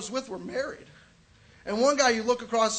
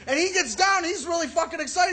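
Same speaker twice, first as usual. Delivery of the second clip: ordinary talk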